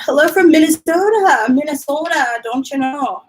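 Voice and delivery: hammed-up Minnesota accent, easing off toward the end. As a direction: read it out in a mock Minnesota accent. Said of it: "I love that accent".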